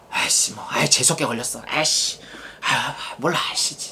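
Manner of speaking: put-on grumbling under the breath like a punished kid; whispered sulk. Child grumbling about getting caught, in a sulky whisper. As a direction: mutter it in a resentful whisper.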